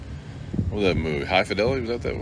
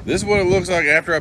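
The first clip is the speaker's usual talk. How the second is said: excited; 12 semitones higher, 7 dB louder than usual. Real, not acted. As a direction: show it off with a flourish. Showing off her gel.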